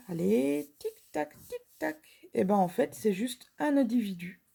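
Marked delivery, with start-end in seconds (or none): 0.1-2.0 light sing-song timer; playful countdown. Pretend. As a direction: tease the viewers to hurry up. Quiz countdown.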